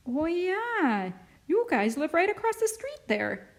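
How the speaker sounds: high, swooping voice of a neighbour realising; dawning recognition. A narrator's reading. Neighbour recognising the children from across the street, with dawning recognition. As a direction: let "yeah" climb high as it clicks.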